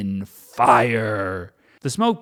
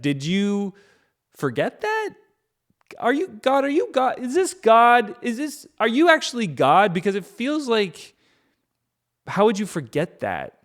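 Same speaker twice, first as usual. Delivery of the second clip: high, incredulous questioning; sarcastic disbelief; 8 semitones higher than usual. Acted. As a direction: needle God for forgetting. Moses questioning God sarcastically.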